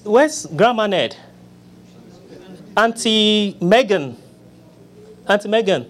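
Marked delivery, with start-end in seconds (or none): none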